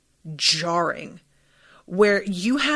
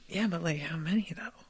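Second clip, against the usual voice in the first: conspiratorial whisper, much softer; whispered aside; 4 semitones lower, 11 dB quieter than usual. Retold or imitated. Viewer nosily asking the show, hushed whisper. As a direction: lean in and whisper the nosy question. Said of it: "And I was just like".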